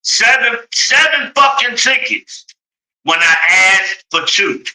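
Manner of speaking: high, incredulous cry; outraged disbelief. Real, not acted. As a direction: hit "Seven" way up in disbelief. Disbelief at ticket count.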